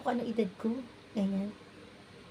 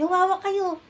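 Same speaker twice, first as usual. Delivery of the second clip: retold shout to hold on, raised high and loud; father's shout; 8 semitones higher, 6 dB louder than usual. Father shouting to his kids during the quake, loud and high. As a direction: call out the warning like a frightened father. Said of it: "sumigaw tatay ko, sabi".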